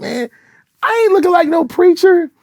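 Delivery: high-pitched indignant denial; mock protest. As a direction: squeal the objection in playful indignation. Mock protest to his daughters.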